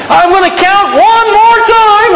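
high, drawn-out parental yell; mock scream of a fed-up parent. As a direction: scream a warning at the kids. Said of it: "If you're screaming, you've crossed the line".

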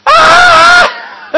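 loud, high scream of fright; terrified scream. Retold or imitated. Disciples see a figure on the water, high loud scream. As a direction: let out a sudden shriek of terror. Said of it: "And they went".

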